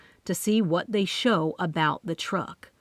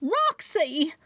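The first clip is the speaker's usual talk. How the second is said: high excited squeal; 9 semitones higher than usual. Acted. Chrissy squeals at Bunny in excitement. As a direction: squeal her name with delight.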